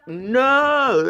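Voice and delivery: a sudden high, loud "No!"; shocked yell. Retold or imitated. Reacting to the episode's twist, a high loud shocked no. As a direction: yell it in disbelief. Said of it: "I was like".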